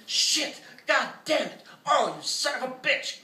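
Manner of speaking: high, loud pained cursing; howled curses. A narrator's reading. Artist cutting hand on fridge metal, howling. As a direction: howl as the hand tears. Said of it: "Griffin howled".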